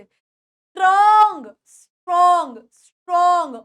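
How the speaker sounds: loud "स्ट्रॉन्ग" with a stretched aw; focused O sound. Shown as a demonstration. Teacher drills स्ट्रॉन्ग for students, emphatic O. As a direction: hit the O in स्ट्रॉन्ग hard and long. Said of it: "ओ की sound पर आप लोग focus करोगे".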